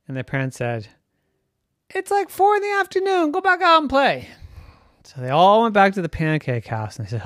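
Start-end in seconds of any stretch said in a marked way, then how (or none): 1.9-4.3 high, hammed-up parent voice; exasperated parent. A narrator's reading. Parents sending hungry boys out, exasperated. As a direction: shoo the kids off in a high voice.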